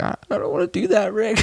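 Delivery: high, reluctant stammering Morty; mock hesitant Morty. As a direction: beg off in a high nervous voice.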